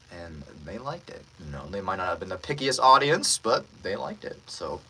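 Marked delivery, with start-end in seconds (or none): none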